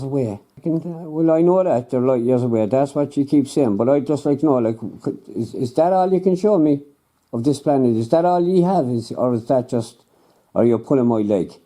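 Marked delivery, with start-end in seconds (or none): none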